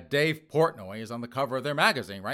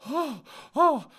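much higher, louder, prissy society-lady falsetto; snooty rich lady; 4 dB louder than usual. Retold or imitated. Host imitating snooty Nantucket liberals, high, fainting society-lady voice. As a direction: clutch the pearls and swoon in falsetto. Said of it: "liberals out on Nantucket Island going".

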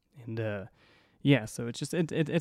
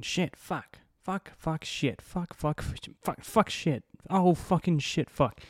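higher-pitched, flustered string of curses; flustered cursing; 5 semitones higher, slower than usual. Retold or imitated. Imitating an upset older woman at her car, flustered higher-pitched swearing. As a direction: rattle off swears like someone who rarely curses. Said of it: "you can tell they don't curse a lot".

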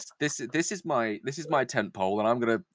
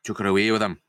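lower-pitched drifting Scottish-northern Lizzie; vague northern accent; 6 semitones lower than usual. Acted. Lizzie explains how he took her away, vague northern accent. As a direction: recount it in a roughly faked northern accent. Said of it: "go for a vague northern accent".

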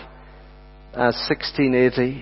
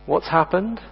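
normal talking voice

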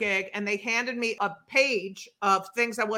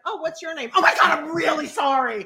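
higher, laughing self-quote, sudden apology; mock panicked apology; 5 semitones higher, 4 dB louder, faster than usual. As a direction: act out catching yourself and apologising profusely. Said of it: "the whole time I was like".

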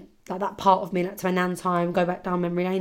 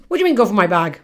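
indignant nan; 6 dB louder, much faster than usual. Retold or imitated. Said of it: "She's going".

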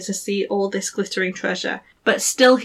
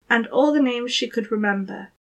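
ordinary delivery

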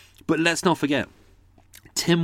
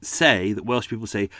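ordinary talk

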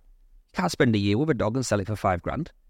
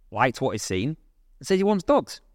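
ordinary talk

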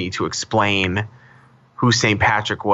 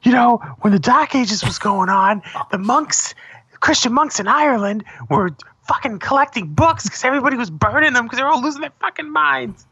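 worked-up rant; 12 semitones higher than usual. Retold or imitated. Heated rant retold.

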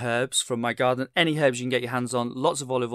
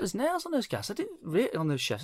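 high-pitched incredulous quote; mock disbelief in a raised voice; retold amazement; 8 semitones higher than usual. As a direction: re-enact your surprise, pitched well up. Retelling own remark to chef.

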